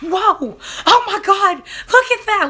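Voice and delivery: climbing high and loud in amazement; freaking out. Retold or imitated. Student doctor watching the baby pull himself out, freaking out. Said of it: "he started freaking out".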